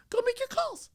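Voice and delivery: high, loud exasperated command; exasperated shout. Real, not acted. Host yelling at new agents to go make calls, exasperated shout. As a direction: yell it at the lazy new agent.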